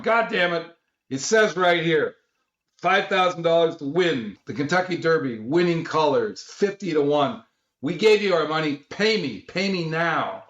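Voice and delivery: high, heated mock outburst, slowing on the last words; agitated demand. Dino shouting at the cashier for the Derby money, high agitated mock outburst. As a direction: demand the payout right in his face. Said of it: "he gets really agitated".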